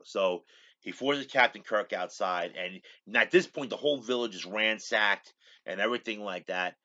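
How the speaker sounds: ordinary talk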